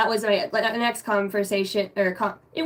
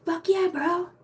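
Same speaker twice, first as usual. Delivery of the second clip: higher, hyped bro voice; pumped-up bro; 8 semitones higher, slower than usual. Retold or imitated. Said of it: "My spirit's like".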